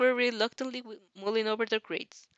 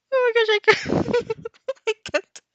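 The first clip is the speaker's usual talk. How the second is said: much higher, loud helpless laughter; real giggle fit, can't get words out; laughing fit; 6 dB louder than usual. Real, not acted. Narrator cracking up at her own line, helpless laughter.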